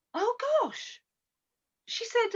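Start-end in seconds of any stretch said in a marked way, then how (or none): 0.1-1.0 Laura's surprised reply. Retold or imitated. Caller Laura answering surprised.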